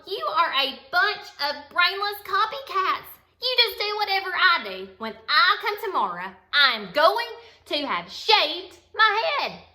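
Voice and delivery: loud, high furious yell. Acted. Stephanie yelling at her brainless copycat classmates. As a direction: shout it at the copycats. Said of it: "Stephanie yelled".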